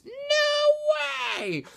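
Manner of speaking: very high, loud squeal; comic falsetto amazement; astonished disbelief. Acted. First guy's astonished reply to bet.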